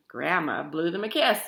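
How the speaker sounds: ordinary delivery, nothing marked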